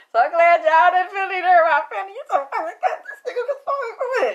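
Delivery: high, loud squeal through laughter; cracking up. Real, not acted. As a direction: squeal the words while laughing hard. Cracking up while retelling fan's praise.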